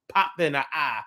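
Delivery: ordinary delivery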